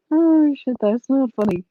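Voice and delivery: high, loud, between real laughs; laughing. Real, not acted. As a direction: protest while cracking up. Laughing at her broken-bone joke.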